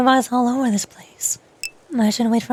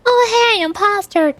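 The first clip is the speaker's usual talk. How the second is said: bright jump much higher on "Oh, hey", then a teasing call of "imposter!"; mock cheery announcement; 7 semitones higher, slower than usual. Player greeting friends at round start, in a mock cheerful call. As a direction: sing it out like you're happily outing yourself. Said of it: "I would just always say".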